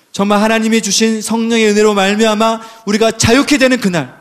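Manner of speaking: ordinary delivery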